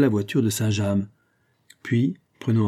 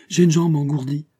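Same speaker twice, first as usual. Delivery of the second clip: higher, louder waking complaint; crying out; 5 semitones higher, 4 dB louder than usual. A narrator's reading. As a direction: blurt it out just roused from sleep. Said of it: "cria Baudard qui se réveillait". (plainte lancée plus haut en se réveillant)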